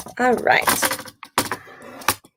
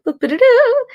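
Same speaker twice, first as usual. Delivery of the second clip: playful high boop; 13 semitones higher, 6 dB louder than usual. Real, not acted. Playful sound while showing phone.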